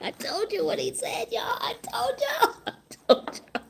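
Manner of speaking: breathy laughing I-told-you-so; gloating through laughter; giggling. Real, not acted. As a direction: giggle it out under your breath.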